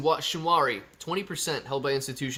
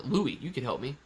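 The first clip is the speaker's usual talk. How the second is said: ordinary talk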